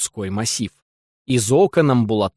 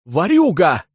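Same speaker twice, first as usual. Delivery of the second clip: shout; 5 semitones higher, 4 dB louder, slower than usual. A narrator's reading. Koreiko exposes the thief.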